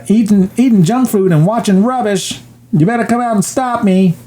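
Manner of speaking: high-pitched mock kid taunt; Kevin. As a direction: dare the grown-ups like a naughty child. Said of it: "And Kevin, who's watching the movie, says".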